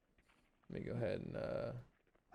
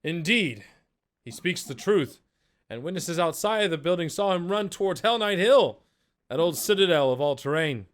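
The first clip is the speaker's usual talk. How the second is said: higher, louder guard voice with a put-on accent; accented guard shout; 5 semitones higher, 6 dB louder than usual. Second guard confirming the story to the crowd, loud with an accent. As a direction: bark it out like a soldier backing up his comrade. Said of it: "the second guard shouts in, uh, in accent".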